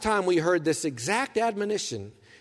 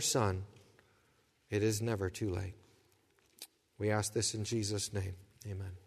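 prayer; 8 semitones lower, 4 dB quieter than usual. Acted. Pastor leads an opening prayer.

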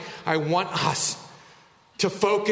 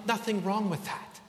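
ordinary talk, nothing marked

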